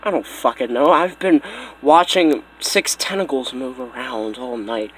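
low, soft, sleepy mumble; groggy. Acted. Buddy just woken up at night replies, groggy. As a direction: answer as if half asleep.

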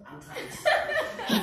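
real squealing laugh; high laughter. Real, not acted. Mother laughing with daughter during muscle stimulation, high giggles.

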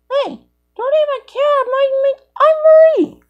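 very high pretend character voice; a tiny squeaky cartoon voice; squeaky toy voice. A child voicing a toy, very high and squeaky.

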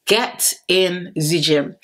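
slow mock-serious order; slow, emphatic repeat. Shown as a demonstration. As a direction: drag out "Get in the gym" slowly. Repeats slowly for effect.

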